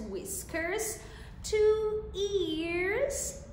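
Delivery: ordinary delivery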